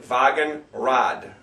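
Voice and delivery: slow "Wagenrad" starting with a V. Shown as a demonstration. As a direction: say "Wagenrad" as "VAH-gen-rahd", unhurried. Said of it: "it sounds like a V".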